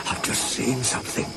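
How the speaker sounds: hushed, shaky whisper; Toby whispers, scared of what he saw. A narrator's reading. Toby telling Thomas about the ghost, shaky and hushed. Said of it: "said Toby in a shaky voice".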